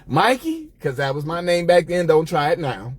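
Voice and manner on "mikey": high sing-song call of Mikey; cousin calling. Retold or imitated. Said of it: "And she walks in the room and says".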